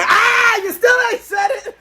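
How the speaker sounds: high, shrieked outburst; mock shriek. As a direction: act out a shrieking reaction, far above normal pitch. Translator's outburst acted in retelling.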